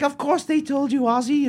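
high-pitched wifely scolding impression; imitating Sharon. Wife corrects her forgetful husband, high mock scolding.

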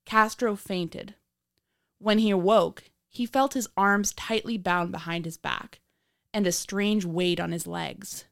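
true crime narrator. A narrator's reading.

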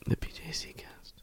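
unvoiced stage whisper. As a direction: whisper the title. Correcting show name.

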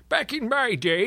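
put-on high, drawn-out old codger; slow, high-pitched old-timer reminiscing; old man voice. Gamer jokes about multiplayer as a kid, old man voice. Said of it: "the same old man voice".

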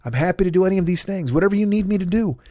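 ordinary talk, nothing marked

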